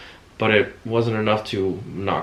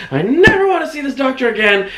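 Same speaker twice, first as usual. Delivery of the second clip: angry refusal; 5 dB louder than usual. Retold or imitated. Janice refusing the doctor.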